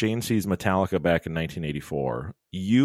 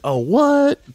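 squeaky high, louder startled what; mock surprised guard; 10 dB louder than usual. Speaker imitates screener reacting to microphone, high surprised squeak. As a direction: play the guard as baffled, way up high. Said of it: "He's like".

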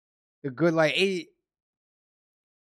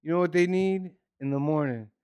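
ordinary delivery

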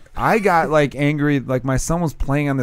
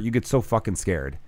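ordinary talk

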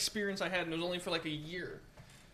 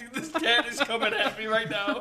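louder and higher, laughing through it; laughing mock alarm; 5 semitones higher, 10 dB louder than usual. Telling friends about her cat, mock alarm while laughing. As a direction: play the panic for laughs. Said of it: "And I'm like".

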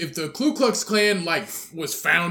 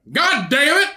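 high, loud hammed-up outburst; mock outraged shopper; 9 semitones higher, 4 dB louder than usual. Shopper spotting green-lid mayo, hammed-up loud outrage. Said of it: "he's just like".

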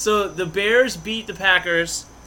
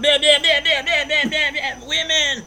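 high, loud mock grunting; 10 semitones higher, 7 dB louder than usual. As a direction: ham up the effort sounds. Mocking grunts during sex.

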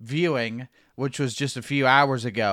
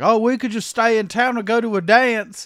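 higher, louder mock British girl; 8 semitones higher, 5 dB louder than usual. Retelling a character from the movie, in a mock British accent. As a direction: ham up a chirpy English girl. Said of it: "and she's like".

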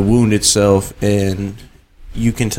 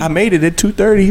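mock wife reply; 8 semitones higher, faster than usual. Acted wife answering.

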